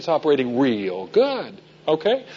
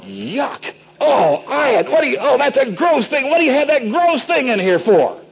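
disgusted guest; 9 semitones higher, slower than usual. Retold or imitated. Guest recoiling from Ian's Thunderbird, high disgusted squeal. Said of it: "they'd say something like".

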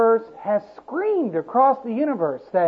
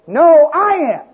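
louder and higher, a defiant retort; 7 semitones higher, 7 dB louder than usual. Acted. Man defiantly contradicting God, louder and higher. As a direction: throw it back in God's face.

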